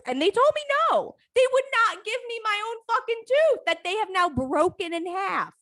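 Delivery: high, loud disbelief; indignant outrage. Real, not acted. Outraged that the dentist kept her tooth, high and loud. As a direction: sound scandalised.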